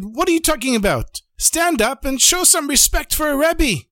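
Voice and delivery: high-pitched demand for respect; acted outraged order; indignant scolding. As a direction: berate him, pitch climbing way up. Rebbe berates a sick chassid.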